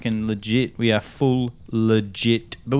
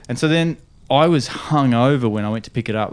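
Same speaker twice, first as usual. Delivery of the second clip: normal talking voice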